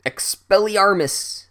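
high, loud, drawn-out incantation; hammed-up wizard battle cry; yelled spell. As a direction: cast it with a slow, high yell. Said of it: "Snape yells out".

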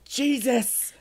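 high, slow, hammed-up hiss; hissed Jesus. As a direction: drag out a mock hiss. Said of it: "I hissed".

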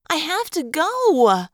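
slow, drawn-out whine; whining. Acted. Woman outside the bathroom door pleads, whining. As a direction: plead like you can't wait. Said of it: "a woman's voice whined".

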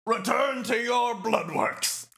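high, theatrical command; imitating arena pomp; arena announcer. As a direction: proclaim it like a game announcer. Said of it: "the Oblivion Arena".

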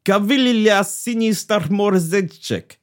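hammed-up Russian villain gloating; spring the trap in a cartoon Russian accent; mock Russian accent.

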